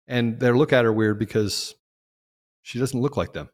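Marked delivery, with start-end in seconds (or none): none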